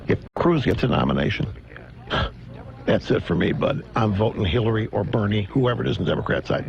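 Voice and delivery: lightly voiced disgusted Republican; mock leaking senator. Imitating an anonymous senator leaking.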